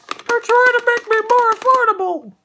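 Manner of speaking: high, squeaky sarcastic voice; mock company voice. Reviewer parodying the toy company, high squeaky sarcastic voice. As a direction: parody the toy maker's excuses. Mocking toy maker's excuses.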